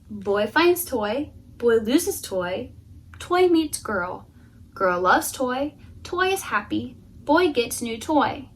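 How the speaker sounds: slow, clipped-word pacing; deadpan slow storytelling; slow telegraphic telling. Acted. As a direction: spell out each beat slowly. Terse minimalist story.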